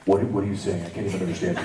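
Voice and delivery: low character voice; put-on deep voice, uncomprehending; Scar. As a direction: answer low and baffled. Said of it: "Scar would be like".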